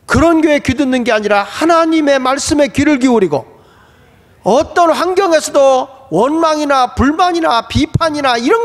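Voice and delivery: impassioned sermon climbing in pitch; emphatic preaching. Acted. Preacher holding up the mother's faith to the congregation, impassioned preaching. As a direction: build to a high, insistent appeal.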